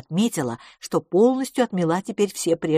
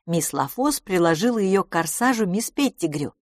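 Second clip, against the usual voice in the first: usual speaking voice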